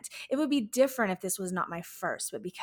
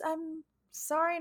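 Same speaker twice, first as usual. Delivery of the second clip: ordinary talk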